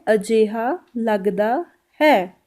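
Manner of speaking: very slow Punjabi phrase; very slow demo. Shown as a demonstration. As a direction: drag each syllable for learners. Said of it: "Punjabi में बोला जाता है". (बहुत धीमे पंजाबी वाक्यांश)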